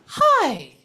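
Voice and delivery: high, loud, airy little hi; light airy hi. Shown as a demonstration. Medium demonstrating the spirit's light airy hello. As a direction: lift it up light and breathy like a greeting from spirit. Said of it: "this is the way it sounds".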